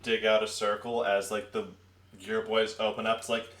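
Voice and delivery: usual speaking voice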